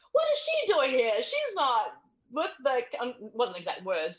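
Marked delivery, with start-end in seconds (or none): 0.1-3.2 high, loud disbelief that slows at the end; incredulous commentary. Retold or imitated. Commentators baffled by her return, high incredulous voice. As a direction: mimic a shocked commentator. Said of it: "they're like".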